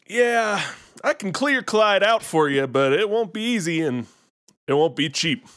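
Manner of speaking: lightly played Kentucky ghost exterminator accent, unfazed; a hint of a Kentucky old-timer who's seen it all; Kentucky twang, laid-back and sure of himself. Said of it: "but you did the accent".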